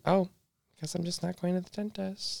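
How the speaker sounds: small, high, soft voice; soft high-pitched "oh well" to oneself; mock resigned. Retold resigned reaction to herself.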